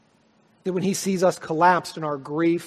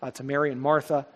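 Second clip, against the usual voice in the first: normal talking voice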